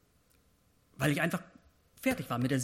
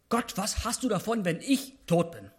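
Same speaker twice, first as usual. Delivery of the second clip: higher and louder, pressing plea; clear demand; 6 semitones higher, 5 dB louder than usual. Shown as a demonstration. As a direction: demand it boldly from God. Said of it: "sagt Gott klipp und klar".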